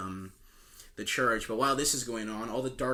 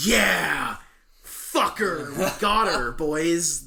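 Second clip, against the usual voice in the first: mock cheer; 4 semitones higher, 5 dB louder, much slower than usual. Fae cheering over the goat.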